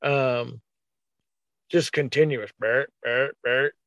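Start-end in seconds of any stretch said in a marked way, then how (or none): 2.6-3.7 low, clipped "bert, bert" grunts; imitating a deer's grunts; deer grunt imitation. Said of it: "He must have grunted".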